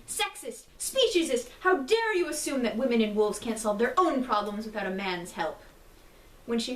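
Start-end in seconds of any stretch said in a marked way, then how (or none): 0.1-1.5 high, loud accusing outburst; outraged cry. Acted. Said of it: "she exclaimed". 1.6-5.6 indignant. Acted. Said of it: "she exclaimed".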